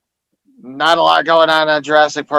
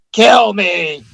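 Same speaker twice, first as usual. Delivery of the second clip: higher, drawn-out cry for help; mock scream; 4 semitones higher than usual. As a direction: cry out like a suffering creature, hammed-up. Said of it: "they just keep screaming".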